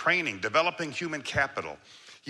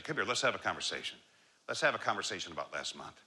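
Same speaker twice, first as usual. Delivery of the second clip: softer, lower, easy call to talk; low casual invitation; 7 semitones lower, 5 dB quieter, faster than usual. Shown as a demonstration. Coach calls an employee over for a talk, softer and low. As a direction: invite them in gently and casually. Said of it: "Low, slow, casual conversation".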